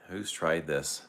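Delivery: ordinary talk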